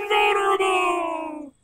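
very high, long-held cry; triumphant proclamation. Acted. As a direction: sing out the word in drawn-out triumph. Masked character proclaims his new power.

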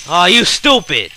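angry outburst. Real, not acted. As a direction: snap at the enemy in frustration. Raging at enemy in game.

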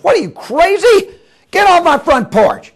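high, incredulous angry shout; outraged yell. Acted. Plane owner to stranger on his porch, outraged yell. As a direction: chase him off at the top of your voice.

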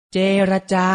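เจรจา said carefully as je-ra-ja; careful teaching read of เจรจา; pronunciation model. Shown as a demonstration. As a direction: model เจรจา for learners in three clear syllables. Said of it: "ออกเสียงพร้อมๆพี่ไทยให้ถูกต้อง".

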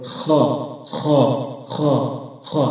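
"খ" scraped from the upper throat; raspy fricative "খ", each one set apart; demonstrated Arabic kha. Shown as a demonstration. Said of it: "এখান থেকে আমরা খ হরফটি উচ্চারণ করব".

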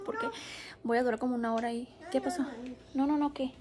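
ordinary delivery

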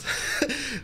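high laugh of disbelief; short pained laugh; real rueful laugh. Real, not acted. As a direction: laugh it off in despair. Troll lost, laughs in dismay.

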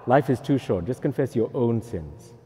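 usual speaking voice

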